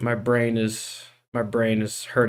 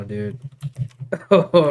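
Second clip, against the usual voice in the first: ordinary talk, nothing marked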